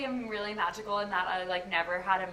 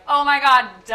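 loud, high sudden "oh my God"; excited realization; 5 semitones higher, 14 dB louder than usual. Retold or imitated. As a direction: blurt out the realization. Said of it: "And I was like".

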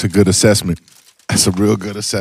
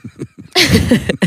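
sudden high laugh; high burst of laughter; 11 semitones higher, 3 dB louder than usual. Real, not acted. Laughing at Ace's delay.

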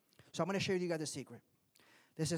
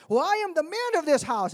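loud, higher self-important boast; proud husband; 10 semitones higher, 10 dB louder than usual. Retold or imitated. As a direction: puff up and claim authority to the wife. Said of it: "I've told my wife".

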